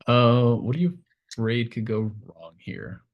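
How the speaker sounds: ordinary delivery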